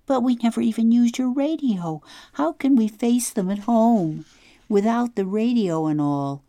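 put-on an upset girl listing what they'd lose; a hint of a fretful young girl; Claudia, upset girl.

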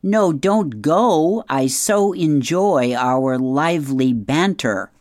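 loud, slow sarcastic dad yell; drawn-out mock pleading, loudly; yelling after someone. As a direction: call out slowly to a departing son. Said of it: "Peter yells after him".

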